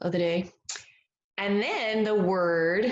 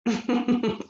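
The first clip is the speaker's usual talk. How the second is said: quick, higher giggle; a short run of amused chuckles; light chuckle; 5 semitones higher than usual. Real, not acted. Amused by the page.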